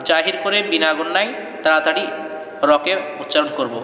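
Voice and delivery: usual speaking voice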